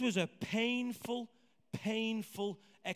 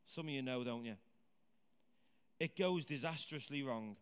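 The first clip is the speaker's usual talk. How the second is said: ordinary talk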